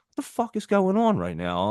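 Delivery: high mock outrage at himself; high-pitched mock exasperation; exasperated. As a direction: replay his own disbelief. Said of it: "I'm like".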